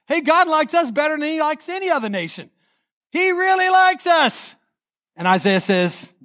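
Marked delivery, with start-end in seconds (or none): 0.0-4.5 mocking the chosen people's smugness, rising high; smug boast. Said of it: "They think they're God's favorites".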